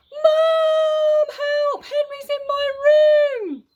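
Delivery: high, loud, slow wail for help; shouting for Mum. A narrator's reading. Peter shouting for Mum to come help. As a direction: call Mum up the stairs in panic.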